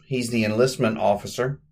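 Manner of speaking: normal talking voice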